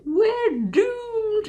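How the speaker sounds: very high, drawn-out Scottish wail of doom; Sergeant Fraser. Acted. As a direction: cry doom in a high-pitched Scottish accent. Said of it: "in a Scottish accent".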